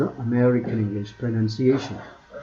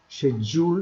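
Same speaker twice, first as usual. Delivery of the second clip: ഷെഡ്യൂൾ as shed-yool in British English; British schedule; 3 semitones higher, much slower than usual. Shown as a demonstration. Teacher contrasting British schedule for students, British accent. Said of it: "British ഇംഗ്ലീഷാണ്".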